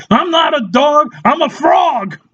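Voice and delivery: high mock-indignant pet voice; hammed-up high animal protest; offended pet. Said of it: "Who's a cute little doggy?".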